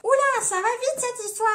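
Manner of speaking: very high, mock-startled voice; high-pitched playful alarm. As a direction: back off in a loud high put-on voice. Run-through of [name]'s startled reply.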